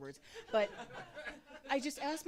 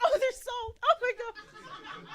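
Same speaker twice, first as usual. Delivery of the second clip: much higher and louder, delighted squeal; excited about salt; 8 dB louder, slower than usual. Retold or imitated. Comic delighted at salt on the restaurant table, squealing high. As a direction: gush over the salt like a prize. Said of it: "I'm like".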